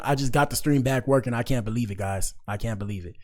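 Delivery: normal talking voice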